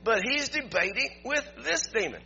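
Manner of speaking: preaching. Acted. Preaching builds in intensity.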